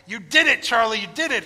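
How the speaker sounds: Wonka's loud, delighted cheer; joyful cry. Retold or imitated. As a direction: celebrate with him.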